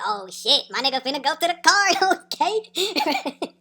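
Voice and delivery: loud, rising, breaking into laughter; gleeful retold outburst; hyped reaction.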